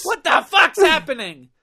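high, loud incredulous rant; stoned existential rant. Retold or imitated. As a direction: rave about the madness, baked. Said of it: "Yeah, like, what?".

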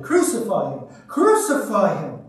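loud crowd cry, building on the repeat; insistent mob shout; shouted demand. A narrator's reading. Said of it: "they shouted".

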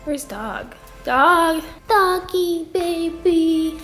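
ordinary delivery

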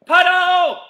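excited game call; shouted command. Acted.

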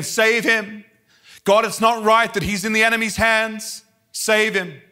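high, pleading appeal to God; retold weeping prayer. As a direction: plead urgently, re-enacting a past prayer. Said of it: "I start weeping again".

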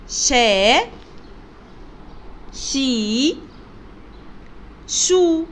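شا، شي، شو each sounded separately with a long vowel; letter-sound demonstration. Shown as a demonstration. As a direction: model شا، شي، شو one by one, drawn out for learners. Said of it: "نشوف أصوات حرف الشين".

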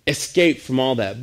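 ordinary delivery

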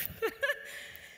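a high airy giggle; breathy giggle. Real, not acted. Speaker to audience, a high airy giggle. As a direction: giggle at her not knowing the fabrics. Laughs about the nervous girl.

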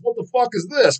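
lightly voiced mother, puzzled; imitating mom. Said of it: "even my mom asked".

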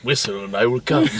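mock-creepy android whisper; quoting David. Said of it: "This went beyond undertone".

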